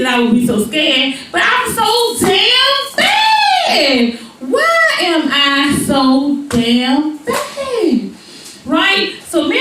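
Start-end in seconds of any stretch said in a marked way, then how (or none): 1.3-8.1 drawn-out comic wail; hammed-up bewailing, words held long; mock lament. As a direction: stretch it into an exaggerated, slow cry. Mock lament over being scared.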